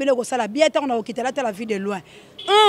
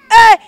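sudden loud "eh!"; shouted exclamation; 10 semitones higher, 14 dB louder than usual. Real, not acted. Host breaking off his greetings, shouted exclamation. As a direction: let out one sharp yell.

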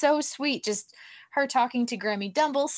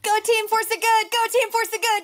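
high-pitched, rapid cheerleader chant; excited, repeated rally cry; team cheer; 10 semitones higher, 3 dB louder, faster than usual. Pretend. Cheering for her trivia team.

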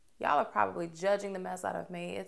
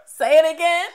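playful demand; 8 semitones higher, 13 dB louder than usual. Real, not acted. Demanding more praise, playful.

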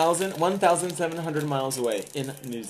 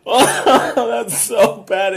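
very slow laughing whine; 6 semitones higher, 7 dB louder, much slower than usual. Real, not acted. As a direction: laugh it out slowly. Tasting awful candy, laughing.

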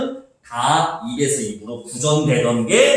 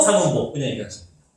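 ordinary talk

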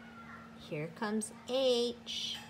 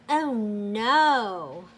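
louder "oh no" as the tree tips; sudden alarm, like watching it topple; dismayed gasp; 9 dB louder than usual. A narrator's reading. Reader to listening children, loud dismayed oh no.

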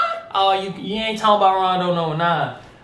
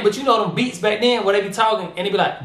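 ordinary talk, nothing marked

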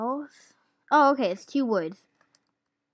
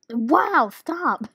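startled outburst; 5 semitones higher, 7 dB louder than usual. Real, not acted. Hiccup interrupts gameplay.

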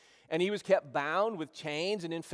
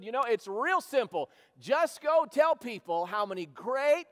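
preaching at full force, higher and louder; fiery preaching; 6 semitones higher, 4 dB louder than usual. Real, not acted. Preacher urging the church to share Jesus, fiery preaching. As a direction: rouse the congregation to go tell people.

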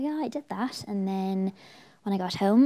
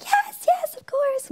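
very high, loud squealed acceptance; retold excited scream; 8 dB louder, much slower than usual. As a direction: re-enact screaming with delight. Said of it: "I just screamed in the kitchen".

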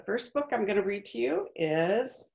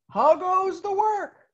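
higher, louder, slow farmer's call; 12 semitones higher, 7 dB louder, slower than usual. Acted. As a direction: call out lazily from bed. Farmer calls again.

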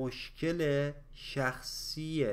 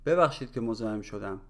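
quick, run together in one breath; natural conversational speed; 4 semitones lower, much faster than usual. Shown as a demonstration. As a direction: say it fast like everyday talk. Same phrase at natural speed. (سریع و روان، با سرعت محاوره)

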